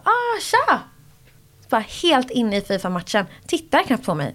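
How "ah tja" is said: offhand greeting. Retold or imitated. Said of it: "De bara".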